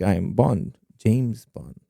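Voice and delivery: a hushed, lightly voiced leprechaun Bond; leprechaun-style James Bond. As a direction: softly imitate a botched Bond impression. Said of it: "he does his leprechaun impression".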